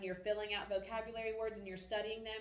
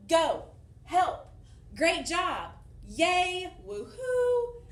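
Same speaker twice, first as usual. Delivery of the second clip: much louder and higher, each one exclaimed; strong feeling interjections; 7 semitones higher, 9 dB louder, much slower than usual. Shown as a demonstration. Teacher exclaiming interjection examples loudly. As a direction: call them out like real cheers. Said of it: "They show strong feeling".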